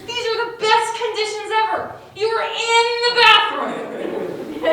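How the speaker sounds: high, slow mock delight; ironic glee. Kid hiding in a bathroom stall, high, slow and gleeful. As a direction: stretch it out like it's perfect luck. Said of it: "And you're thinking".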